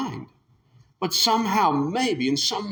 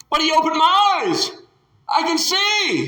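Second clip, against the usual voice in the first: high, loud wonder; a healed man amazed, exclaiming; joyful exclamation; 14 semitones higher, 5 dB louder than usual. Acted. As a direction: burst out that you can see.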